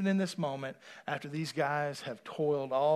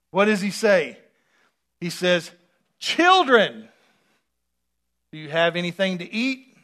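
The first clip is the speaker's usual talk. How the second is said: loud, higher preacher cadence, slow at first; preaching at full force; 7 semitones higher, 15 dB louder, slower than usual. Acted. Preacher revealing what Jesus really says, loud and fervent. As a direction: proclaim it to the congregation.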